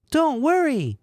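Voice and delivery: put-on very high falsetto girl voice; squeaky, hammed-up reassurance; Sarah voice.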